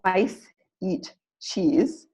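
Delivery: slow, BIRDS and WORMS punched on each beat; teacher modelling rhythm, slow and clear; stress-timed demo. Shown as a demonstration. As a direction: clap it out, one beat per stressed word. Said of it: "a couple more for you to try".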